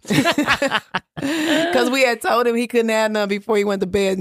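slow, laughing through the words. Real, not acted. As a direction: laugh as you explain the chicken. Laughing while telling story.

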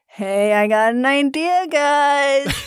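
slow, drawn-out pitch rising to the end; hammed-up executive floating a bright idea; scheming oil executive. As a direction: stretch it out slowly, climbing on the last word. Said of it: "oil executives sitting around going".

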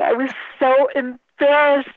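real laughter stretching the words; laughing embarrassment. Real, not acted. Organizer confessing her blunder to the host, laughing helplessly.

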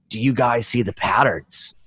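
low, slow, dazed question; lightly acted stoned wonder; spaced-out tripper. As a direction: imitate a friend dreamily staring at a wall. Tripping buddy stares at wall.

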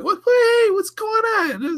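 very high, falsetto innocent Sulu. Retold or imitated. Retelling Sulu's innocent greeting, squeaky high. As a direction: play dumb in a squeaky voice. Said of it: "was Sulu like".

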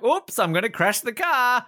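high-pitched playful imitation; mock oops. Imitating Brosnan as he jumps out, mock alarm. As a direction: put on a high, comic 'oops' voice. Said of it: "puts his hands up like".

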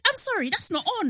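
strict teacher. Retold or imitated. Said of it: "she was doing teacher".

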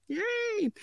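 tiny high-pitched yay; soft, squeaky little hooray; mock cheer. Real, not acted. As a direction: cheer half-heartedly in a small high voice. Mock cheer after a dull career summary.

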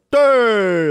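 loud, high bar shout; drunk cheer. Retold or imitated. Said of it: "drunk people are just like".

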